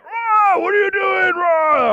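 high, loud frustrated yell; frustrated shout. Retold or imitated. Pastor driving into a one-way street, frustrated high shout. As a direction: shout at the oncoming car in a panic. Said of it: "all frustrated".

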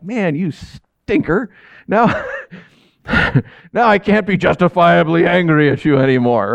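acted anger giving way to laughter; laughing in spite of himself. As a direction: try to scold but crack up. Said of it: "I start laughing, right? I can't help it".

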